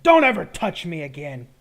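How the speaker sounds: loud, sharp acted outburst; angry soldier. Soldier rebuking someone who touched him, angry shout. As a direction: warn them off with real force. Soldier angrily smacks hand away.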